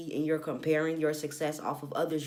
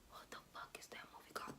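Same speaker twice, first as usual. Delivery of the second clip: fast whispered aside; whispering; 26 dB quieter, faster than usual. Real, not acted.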